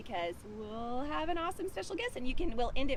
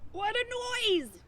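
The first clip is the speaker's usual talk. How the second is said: high, loud startled cry; startled pig; 12 semitones higher, 4 dB louder than usual. Acted. Pig jolted awake, high startled cry. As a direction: yelp it like a woken pig. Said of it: "cried the pig".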